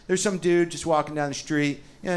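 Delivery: ordinary delivery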